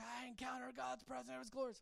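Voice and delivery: mock-dramatic gushing testimony, pitched high. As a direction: imitate an over-the-top testimony. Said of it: "all these dramatic testimonies and people say".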